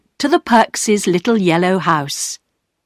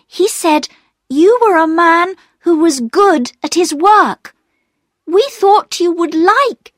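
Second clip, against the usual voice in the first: put-on higher pleading girl's voice; earnest young girl; 9 semitones higher than usual. A narrator's reading. Girl explains gifts to Perks, earnest pleading. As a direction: convince him gently. Bobby's voice continues.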